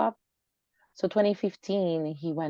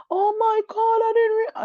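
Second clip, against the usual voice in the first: high, loud mock shock; mock surprise; 7 dB louder than usual. Imitating her boyfriend's fake shock at forgetting her birthday, high and loud. Retelling his surprised reaction.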